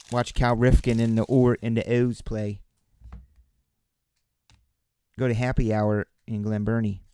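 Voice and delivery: put-on thick Baltimore accent, settling down toward the end; playful Baltimore local accent. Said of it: "I start going into my Baltimore accent".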